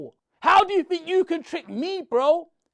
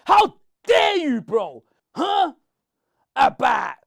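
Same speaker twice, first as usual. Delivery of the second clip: ordinary talk, nothing marked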